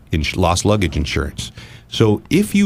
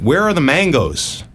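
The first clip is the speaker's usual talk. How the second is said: raised, drawn-out puzzled query; retold innocent asking, pitched up; naive question; 7 semitones higher than usual. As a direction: ask it in earnest bewilderment.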